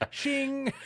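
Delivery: high metallic shing of a blade drawn; pitchfork being drawn. Retold or imitated. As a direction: voice the ring of steel sliding free. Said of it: "I heard the pitchfork slide out".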